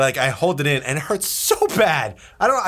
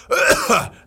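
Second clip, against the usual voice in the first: forced throat clearing; 11 semitones higher, 4 dB louder than usual. Shown as a demonstration. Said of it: "I was like this".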